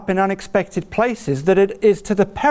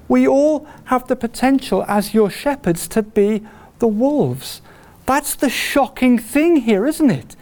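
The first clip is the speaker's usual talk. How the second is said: normal talking voice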